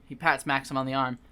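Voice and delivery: usual speaking voice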